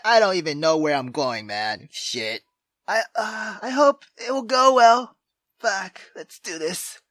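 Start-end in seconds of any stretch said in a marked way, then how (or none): none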